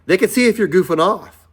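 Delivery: high and loud, amazed at the tech; excited. Real, not acted. Dad telling viewers about his son's training, high and excited. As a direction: really sell how wild it is.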